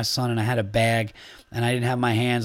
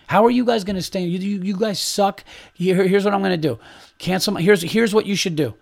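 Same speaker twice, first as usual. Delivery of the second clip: indignant rant, pitched higher; worked up; 4 semitones higher than usual. Acted. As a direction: get worked up chewing out the restaurant staff. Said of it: "I'm getting worked up right now".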